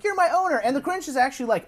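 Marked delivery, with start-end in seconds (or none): 0.0-0.6 high, adoring pet voice; eager dog voice. Retold or imitated. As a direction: play the devoted dog, pitched way up. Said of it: "Max is just like".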